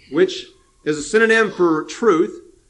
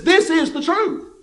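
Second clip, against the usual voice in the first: high, insistent declaration; 9 semitones higher than usual. Retold or imitated. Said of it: "He says".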